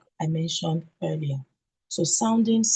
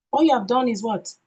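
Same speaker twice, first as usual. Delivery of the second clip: usual speaking voice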